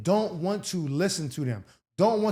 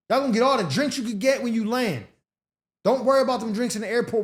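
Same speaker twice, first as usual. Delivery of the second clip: normal talking voice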